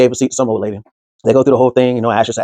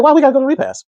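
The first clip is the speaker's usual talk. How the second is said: much higher, puzzled question; mock confused protest; 14 semitones higher, faster than usual. [name] asking his friend why they must go, in mock disbelief. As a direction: pitch it up in disbelief.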